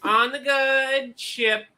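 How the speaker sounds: put-on slow, lilting little-girl voice; sweet child star. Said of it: "Tammy gives me the vibe".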